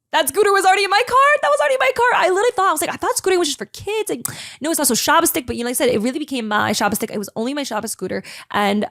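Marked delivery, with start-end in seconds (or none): 0.1-4.1 high, loud, fast burst of excitement; excited. Real, not acted. Telling listeners the scooter was already in her car, excited and high. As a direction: gush like it's the best news ever.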